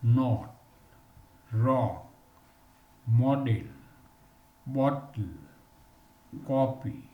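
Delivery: "not, rock, model, bottle, copy" with a short o, spaced apart; short o drill. Shown as a demonstration. Teacher modelling the short o for students, careful drill. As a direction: say each word with a rounded short o.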